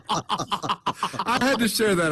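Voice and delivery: slow and high through laughter; words squeezed out while cracking up; laughing. Real, not acted. Still laughing after story.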